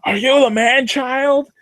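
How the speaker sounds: very high, slow squealing fan; excited fan voice. Retold or imitated. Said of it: "He's like".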